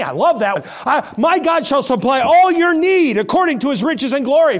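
scripture read with rising fervor; fervent preaching. Acted. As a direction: preach it out with conviction.

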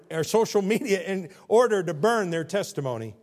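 loud, solemn King James recitation; preacher parodying scripture in a grand voice; mock scripture reading. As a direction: intone it like reading the Bible.

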